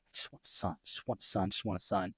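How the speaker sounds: low, very soft muttered repetition; hushed wishing. Retold or imitated. Father-to-be quietly wishing for a boy, hushed. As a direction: chant it under the breath like a private plea. Retold inner wish for a son.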